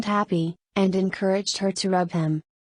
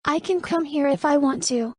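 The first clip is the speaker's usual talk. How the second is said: high-pitched, mildly annoyed retort; higher character voice, a touch of indignation; annoyed newcomer; 7 semitones higher than usual. A narrator's reading. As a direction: stand up to the cook in a raised pitch. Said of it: "Camelia frowned, annoyed".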